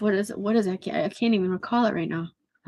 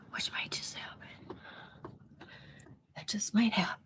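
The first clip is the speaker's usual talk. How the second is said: soft unvoiced whisper; whispered aside; 14 dB quieter than usual. Real, not acted. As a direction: say it under your breath. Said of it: "muttered aside".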